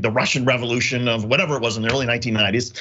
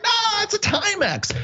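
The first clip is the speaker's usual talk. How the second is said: squeaky protest. Retold or imitated. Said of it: "I'm like".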